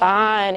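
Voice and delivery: "Fine" with the I saying its name; long I sound. Shown as a demonstration. Teacher showing what silent E does, long I sound. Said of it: "the long sound of the I".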